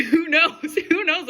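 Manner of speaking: laughs through a rhetorical question; laughing. Real, not acted. Reader laughing at her own old story. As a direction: chuckle it out, amused. Laughing aside about fantasy dolls.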